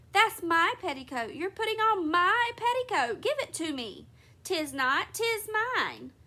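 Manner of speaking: put-on high, loud squabbling kids; quarrelling children. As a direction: fight over the sock like a child. Twins quarrel.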